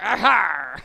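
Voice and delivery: hammy pirate exclamation; bad pirate, hammed up. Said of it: "when I do horrible pirate voices".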